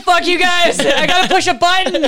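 loud, high mock photographer voice; zing. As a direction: roast the lazy photographer. Mocking a lazy photographer.

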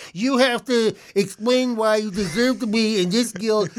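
slow, lofty guild judge; mock solemn tribunal. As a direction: pronounce it like a pompous tribunal, slowly.

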